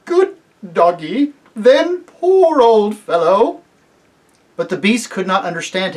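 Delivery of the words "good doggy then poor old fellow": put-on slow, breathy gasps from a frightened old man; trembling gasp, coaxing a dog; gasped and shaky. A narrator's reading. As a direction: gasp it out in terror, slowly. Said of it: "held out his shaking hand and gasped".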